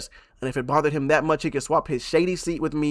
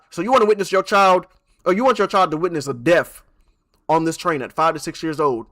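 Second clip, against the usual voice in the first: raised, louder rhetorical challenge; imagined comeback; 3 semitones higher, 6 dB louder than usual. Retold or imitated. Streamer voicing the comeback to the mother, louder and higher. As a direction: throw the question right back at her.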